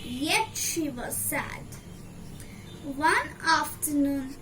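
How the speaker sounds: plain reading voice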